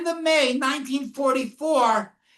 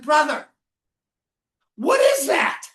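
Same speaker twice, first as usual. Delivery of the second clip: raised call of alarm, louder; 2 semitones higher, 5 dB louder than usual. Retold or imitated. Survivor calls out to a prisoner on arrival, raised call. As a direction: call across to him, startled and loud.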